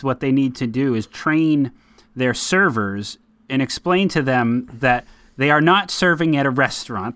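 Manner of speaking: usual speaking voice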